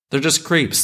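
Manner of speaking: exasperated, hitting creeps hard; exasperated outburst. Shown as a demonstration. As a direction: blurt it out, fed up. Repeating line for emphasis.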